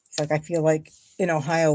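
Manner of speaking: ordinary talk, nothing marked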